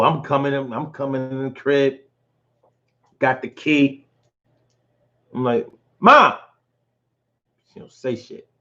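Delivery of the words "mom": loud call up the stairs; mom, are you here. Retold or imitated. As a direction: call for mom through the house. Calling out in empty house.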